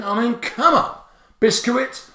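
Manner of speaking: high, rising "Biscuit?" of disbelief; baffled by the spelling; incredulous. Real, not acted. Teacher puzzled by biscuit spelling, high disbelief.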